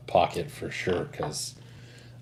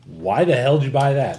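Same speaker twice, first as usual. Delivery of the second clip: higher, louder mock scolding; exasperated dad; 6 semitones higher, 7 dB louder than usual. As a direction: imitate a dad demanding an explanation. Dad's scolding question, acted out.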